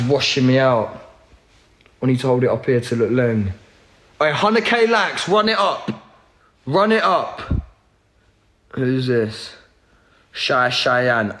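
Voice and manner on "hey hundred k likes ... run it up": higher, excited chant for likes; hype call. Real, not acted. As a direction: pump up the viewers, higher pitch. Hyping viewers for likes.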